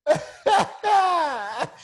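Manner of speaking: high, loud burst of laughter; real laugh. Real, not acted. Cracking up at the joke.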